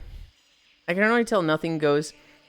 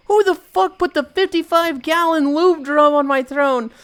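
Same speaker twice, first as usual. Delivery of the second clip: high, loud mock-indignant yell; raised, incredulous mock fury; acted outrage; 10 semitones higher, 6 dB louder than usual. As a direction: play the horrified prank victim demanding a culprit. Voices outraged victim of prank.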